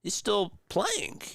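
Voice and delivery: telling chat how he reacted to seeing the player; impressed wow. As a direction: pitch it up like you're genuinely impressed. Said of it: "and I was like".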